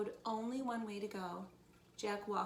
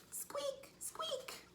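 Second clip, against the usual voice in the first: tiny, very high squeaks; high-pitched mouse voice; 3 dB quieter than usual. Acted.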